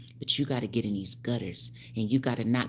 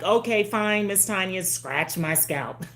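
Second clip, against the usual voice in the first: mock client voice; 8 semitones higher, 5 dB louder, slower than usual. Acts out her clients' voice.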